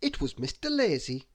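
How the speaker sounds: ordinary delivery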